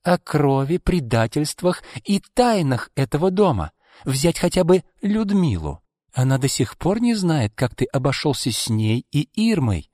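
high-pitched threatening revelation; pointed accusation. A narrator's reading. Izolda threatens Arkady with family secrets, accusing.

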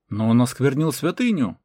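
loud protest, short of a scream. A narrator's reading. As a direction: object with some force. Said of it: "попытался возразить Мартан".

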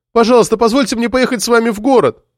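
put-on high-pitched girl pleading over noise; loud plea. A narrator's reading. Girl begs to ride along over the motor, loud high plea. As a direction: plead as if over a running engine. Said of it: "громко попросила девушка, пытаясь перекричать мотор".

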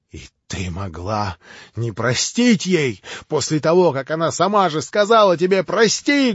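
rising to a high, loud cry; bitter outcry. Acted. Mitya cries out bitterly at Grushenka, high and loud. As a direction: hurl the bitter reproach. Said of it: "горько вскрикнул опять Митя".

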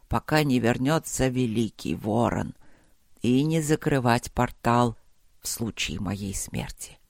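low, measured voice, slowing at phrase ends; solemn old ruler's final instructions; dying emperor, resolute. Acted. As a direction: explain gravely with failing strength. Dying emperor explains his plan.

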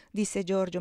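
usual speaking voice

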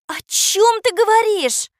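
high, loud, slow indignant retort. Acted. Katya answers the boy's doubt, indignant. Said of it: "возмутилась Катя".

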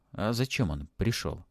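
usual speaking voice

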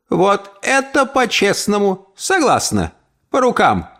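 lightly voiced aunt, pleased; pleased old lady. Aunt accepts deal.

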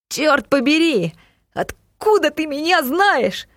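high startled outcry; surprised shout. A narrator's reading. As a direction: cry out in shock. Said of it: "удивлённо закричал он".

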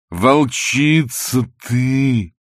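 very slow, loud, drawn-out wail; whining Lokhankin. A narrator's reading. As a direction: stretch it into a long whine. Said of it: "продолжал Лоханкин в том же тягучем тоне". (тягучий громкий вой)

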